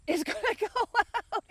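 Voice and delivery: high, laughing through it. Real, not acted. To viewers, about the furnace about to die, laughing. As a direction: giggle at the bad news.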